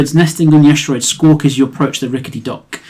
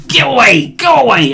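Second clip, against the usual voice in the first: loud snarled shout, higher; old woman shouting birds off her dock; snarling; 9 semitones higher, 5 dB louder than usual. Acted.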